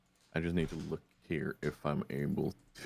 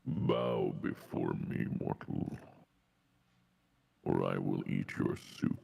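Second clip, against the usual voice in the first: dark lord; 12 semitones lower, 4 dB quieter, slower than usual. Acted. Patron voice continues, menacing.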